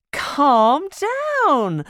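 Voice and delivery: high, firm calming mother; soothing mum. A narrator's reading. Mum calming Splat at the door, soothing. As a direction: settle a jumpy child.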